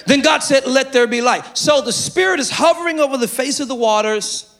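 full-force preaching of the creation verse; preaching shout. Acted.

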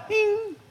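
tiny high-pitched chime sound; ping it high and light like a bell; cartoon smile chime; high "bing". Mimics a smile switching on.

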